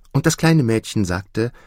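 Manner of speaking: usual speaking voice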